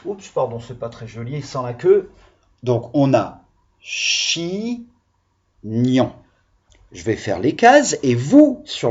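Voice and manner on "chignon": "chignon" slowly in two parts, chi-gnon; "chignon" with a long chi, pause, then gnon; split into syllables. Shown as a demonstration. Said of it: "les syllabes du mot chignon".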